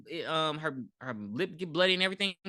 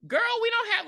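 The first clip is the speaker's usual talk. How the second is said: high exasperated. Retold or imitated. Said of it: "And I'm like".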